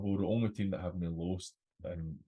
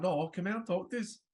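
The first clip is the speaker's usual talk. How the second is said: mock dad call; 11 semitones higher than usual. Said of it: "and you're like".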